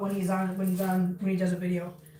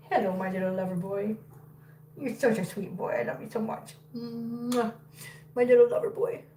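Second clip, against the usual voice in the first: soft cooing; 4 dB quieter than usual. Real, not acted. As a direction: coo softly to a pet. Cooing softly to her dog.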